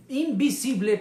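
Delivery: high, loud burst on the word; emphatic proclamation. Acted. Preacher voicing God declaring himself to the world, emphatic proclamation.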